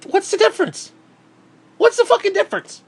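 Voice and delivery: high, incredulous exasperation; exasperated. Real, not acted. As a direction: throw the question up in disbelief. Exasperated rant question.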